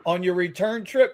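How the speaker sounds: normal talking voice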